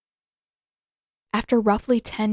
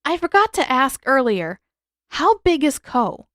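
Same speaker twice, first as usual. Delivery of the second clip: calling out; 5 semitones higher, 5 dB louder than usual. A narrator's reading. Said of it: "Aang called out".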